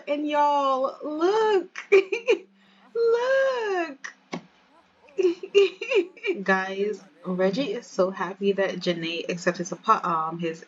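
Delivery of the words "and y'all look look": very high, giddy squeal; excited gushing. Real, not acted. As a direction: squeal over the ring, stretching the word out. Narrator gushing over engagement ring.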